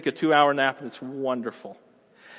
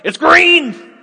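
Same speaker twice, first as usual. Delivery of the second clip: much higher, louder impatient yell at traffic; road rage yelling; 12 semitones higher, 11 dB louder than usual. Retold or imitated. Driver laying on the horn at the car ahead, furious yell. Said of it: "Are you just laying on the horn?".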